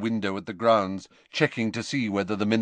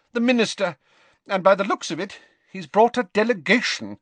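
urgent McGonagall; 11 semitones higher, 4 dB louder than usual. A narrator's reading. McGonagall watching grounds.

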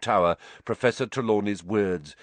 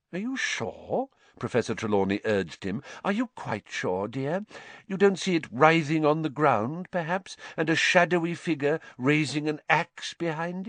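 high, coaxing mystic; hopeful prodding fortune teller; eager seer voice; 7 semitones higher than usual. A narrator's reading. Trelawney presses Harry.

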